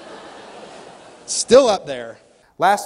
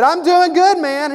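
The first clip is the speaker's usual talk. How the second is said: much higher, loud, upbeat reply; match the salesman's hype; matching his energy; 11 semitones higher, 8 dB louder than usual. Retold or imitated. Customer echoing the salesman's energy, high and loud. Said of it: "And I said".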